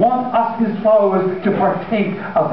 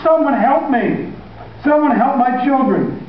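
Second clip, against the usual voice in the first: high, pleading cry for help; panicked father shouting on the beach; desperate call for help; 8 semitones higher than usual. Acted. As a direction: beg strangers to save his kids.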